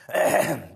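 coughing fit. Real, not acted. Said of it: "Sorry about the coughing today".

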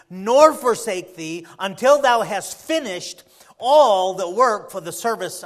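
loud, ringing charge from scripture; emphatic scripture preaching. Real, not acted. Preacher reading David's charge to Solomon, emphatic scripture preaching. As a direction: proclaim it slowly and forcefully, stretching key phrases.

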